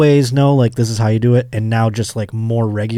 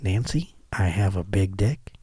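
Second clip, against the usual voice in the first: Reagan impression; 5 semitones lower, 10 dB quieter than usual. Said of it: "presidential impressions".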